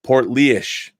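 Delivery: "Port Leish" said as port LEESH, stress on Leish; local pronunciation. Shown as a demonstration. Reading a viewer's comment, says "Port Leish" as port LEESH. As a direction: read out "Port Leish" carefully as the viewer spells it. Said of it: "my hometown is pronounced".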